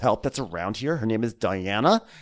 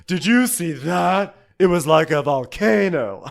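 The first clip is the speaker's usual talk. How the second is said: a touch higher, slow wondering diva; mock Luann; 6 semitones higher, 6 dB louder than usual. Host retelling Luann's swerve, mildly hammed-up diva. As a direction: lightly play the dazzled celebrity. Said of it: "she goes".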